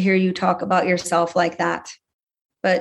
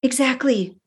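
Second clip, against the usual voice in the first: ordinary talk, nothing marked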